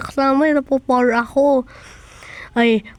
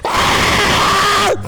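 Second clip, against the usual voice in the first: yell; 7 semitones higher than usual. Acted. Yelling during rough play. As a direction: let out a yell.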